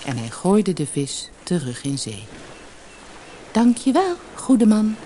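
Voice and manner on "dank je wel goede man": high, glad little fish; delighted goldfish voice, pitched up; happy calling out. A narrator's reading. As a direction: call thanks brightly in a high voice. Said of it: "riep het visje blij".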